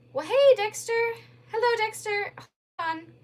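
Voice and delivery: high, loud, forced cheery hello; faking delight at an unwelcome caller; mock bright greeting. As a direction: put on a brittle brightness. Fake cheerful greeting on phone.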